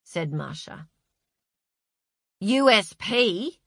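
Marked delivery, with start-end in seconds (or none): 2.4-3.6 blankly. A narrator's reading. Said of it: "asked Tony blankly".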